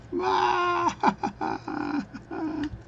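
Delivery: very high squealed laugh breaking into short bursts; high-pitched laugh. Real, not acted. Joke teller laughing at own punchline, high squealing laugh. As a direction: let out a long squeal of laughter, then stutter it out.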